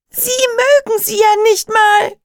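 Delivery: put-on high, loud girl's shout. A narrator's reading. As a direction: shout him down. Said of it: "brüllte Emma zurück, so laut". (laut schreiend)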